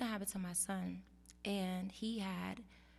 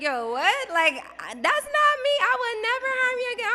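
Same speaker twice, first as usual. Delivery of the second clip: much higher, louder indignant disbelief; mom shocked; 14 dB louder than usual. Retold or imitated. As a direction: protest like a stunned mother. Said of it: "she was looking at me like".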